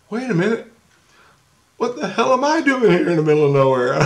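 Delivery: catching yourself mid-thought; sudden realization. Retold or imitated. Questioning himself in the dream, baffled high question. Realizing own strange situation.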